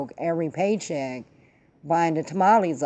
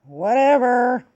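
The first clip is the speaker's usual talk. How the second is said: dismissive; 5 semitones higher, 5 dB louder, slower than usual. Real, not acted. Brushing off the heckle.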